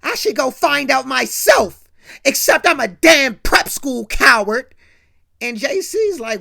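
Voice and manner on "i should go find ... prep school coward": loud, bitter self-blame; bitter outburst. Retold or imitated. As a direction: spit out the self-insult. G lashes out at himself.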